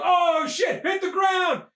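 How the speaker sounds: high warning cry; mock-panicked shout. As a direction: yell a hammed-up alarm to the crowd. Acting out crowd fleeing a bomb.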